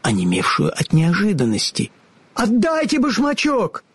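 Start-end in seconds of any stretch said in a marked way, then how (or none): 2.3-3.8 put-on high girlish voice, demanding; little girl crying out. A narrator's reading. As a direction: call out like an upset little girl. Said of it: "закричала Элли".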